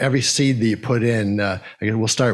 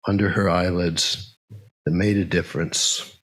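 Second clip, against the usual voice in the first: poem reading. Acted. Reads a nature poem aloud.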